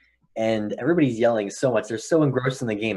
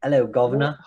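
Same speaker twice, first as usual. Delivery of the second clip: mock British accent; 3 semitones higher, 4 dB louder than usual. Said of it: "not the Ouija accent".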